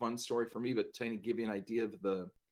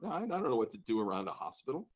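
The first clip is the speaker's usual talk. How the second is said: usual speaking voice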